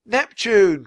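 high, loud name called out; awed cry. A narrator's reading. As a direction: cry out as the giant appears. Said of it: "cried Annie".

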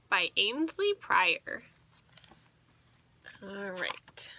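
plain reading voice

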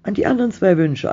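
fast, high, loud reply; quick answer. A narrator's reading. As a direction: answer in an eager rush. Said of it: "antwortete sie schnell".

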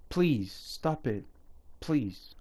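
slow, soft begging; weary plea to stop it; quiet pleading. Real, not acted. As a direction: plead with them, almost giving up. Begging players to stop spending.

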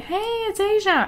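high, bright imitated fan shout-out; cheery fan greeting. Imitating a viewer who loves her rambling, high cheery greeting. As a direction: play an excited viewer saying hi. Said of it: "people love it".

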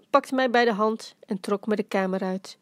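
ordinary delivery

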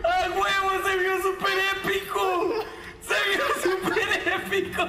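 high, thrilled exclamation with laughter; laughing, high-pitched hype; excited cheering. Real, not acted. Excited reaction to a game clip.

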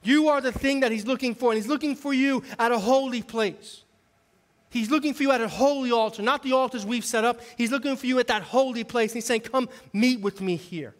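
high, forceful sermon delivery; preaching. Acted.

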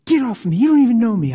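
the girl retold shouting back; imitating her yell. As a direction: throw off his grip as the girl. Prostitute pushes him away, voiced.

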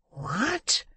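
high, breathy cry of disbelief; amazed. A narrator's reading. Old man hearing the girl's wish, amazed. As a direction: gasp it, sweeping upward.

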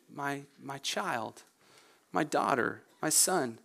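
soft, slow, fatherly appeal; hushed and unhurried, with pauses; tender divine address. Acted. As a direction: speak gently, as the Lord to a beloved child. Voices the Lord addressing his child.

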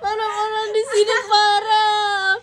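very high, loud and slow wail; loud drawn-out protest. Real, not acted. Vlogger wailing at her friend's teasing. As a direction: wail it out slowly.

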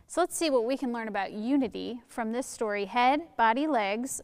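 ordinary delivery, nothing marked

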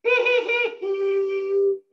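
high giggling hee hee; high alto giggle. Shown as a demonstration. Elementary school laughter, in alto. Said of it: "One more time".

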